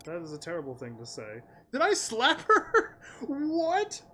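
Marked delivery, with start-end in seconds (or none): 1.7-4.0 much higher, slow, stunned squeak; shocked disbelief. Real, not acted. Shocked at her own choice.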